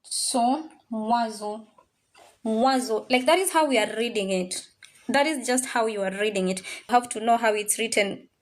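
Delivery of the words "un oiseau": mwazo, oiseau sounded wa-zo; un oiseau run together. Shown as a demonstration. Teacher showing how oiseau is read, run together. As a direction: say mwazo as one flowing word. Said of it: "how are we gonna read this?".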